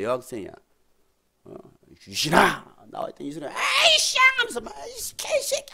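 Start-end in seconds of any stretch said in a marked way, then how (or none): 2.0-3.5 sharp exorcist's call; calling out a demon. Acted. 3.5-4.8 high strained demon voice; shrieking possessed-girl outburst; cursing demon. Acted. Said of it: "귀신을 쫓는데". 4.8-5.7 very high strained swearing; squealed demonic cursing. Acted. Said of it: "막 욕하면 막 별의별 욕을 하더라고".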